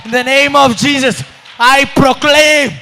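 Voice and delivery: shouted revival preaching; loud, ringing prophetic decree; forceful proclamation. Real, not acted. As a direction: rush the verse, then stretch the English declaration.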